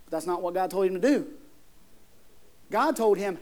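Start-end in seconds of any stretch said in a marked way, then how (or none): none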